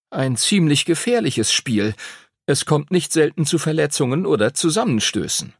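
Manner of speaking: high-pitched schoolboy reciting rules; eager know-it-all pupil. Acted. Arthur continues explaining the game.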